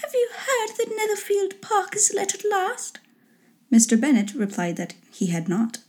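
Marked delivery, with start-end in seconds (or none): 0.0-3.0 very high, lightly voiced lady; put-on high wife voice; gossiping wife. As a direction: pitch it way up to share the news. Wife's eager news in story.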